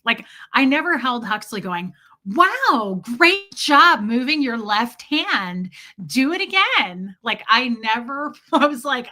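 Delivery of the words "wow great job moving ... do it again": mock gushing, loud, high and slow; over-excited baby praise. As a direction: imitate someone thrilled by an infant, stretching the words. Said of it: "you can't get me to get that excited".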